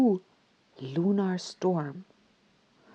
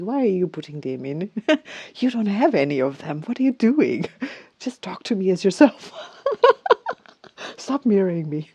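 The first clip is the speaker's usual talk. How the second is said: laughing scolding; 7 dB louder than usual. Retold or imitated. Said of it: "And I say to Chat G P T".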